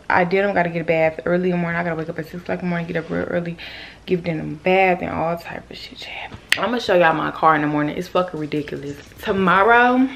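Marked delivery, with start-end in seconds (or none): 5.4-6.3 breathy whispered aside; hushed throwaway whisper. Real, not acted. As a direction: drop to a whisper mid-sentence.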